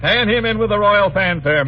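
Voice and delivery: ordinary talk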